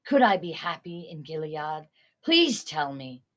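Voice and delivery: ordinary delivery, nothing marked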